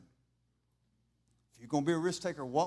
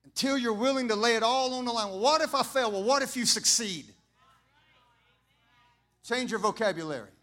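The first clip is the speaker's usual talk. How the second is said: loud, higher call to faith; preaching at full force; 7 semitones higher, 9 dB louder than usual. Acted. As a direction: push back on every doubt with force. Preaching, answering doubts.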